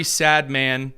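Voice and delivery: ordinary talk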